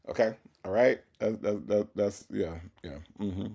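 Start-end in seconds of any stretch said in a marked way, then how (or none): none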